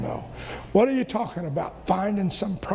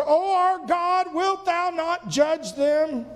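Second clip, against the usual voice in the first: pleading prayer; 7 semitones higher, slower than usual. Acted. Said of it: "He said".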